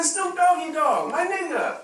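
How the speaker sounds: proud mock reply. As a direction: announce it like a badge of honour. African man cites rap names.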